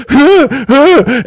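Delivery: sharp high gasps of pain, loud; startled high huffs. Retold or imitated. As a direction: gasp out as if it hurts. Being pulled around in bed.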